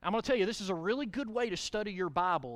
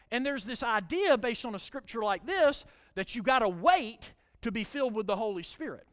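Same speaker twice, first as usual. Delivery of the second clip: usual speaking voice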